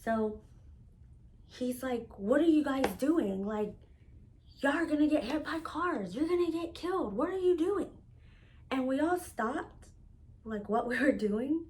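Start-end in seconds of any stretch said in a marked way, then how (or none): 2.2-7.9 rising, higher alarmed bystander yelling; voicing a stranger scolding them to get off the road, higher and alarmed. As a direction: mimic a panicked passerby shouting warnings. Stranger yells at the fight.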